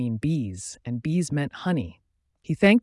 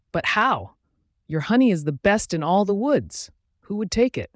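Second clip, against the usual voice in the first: alarmed; 6 semitones higher, 4 dB louder than usual. Acted. Cub shocked honey is gone.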